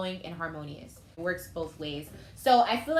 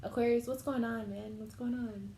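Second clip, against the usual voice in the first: softer, choked-up plea, near crying; close to tears; 3 semitones higher, 4 dB quieter than usual. Real, not acted. Emotional tarot reader pleading with Aquarius, soft and near tears. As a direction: ask gently as if holding back tears. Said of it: "I feel like I'm gonna cry".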